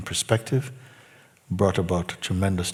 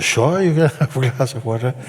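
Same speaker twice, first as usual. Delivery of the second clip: a hint of amused indulgence, louder; mock indulgent; 6 semitones higher, 6 dB louder than usual. Teacher lightly mocking a stalling student, indulgent. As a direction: humour him as if it's no big deal.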